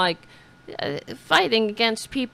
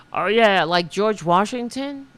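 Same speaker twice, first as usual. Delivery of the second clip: ordinary talk, nothing marked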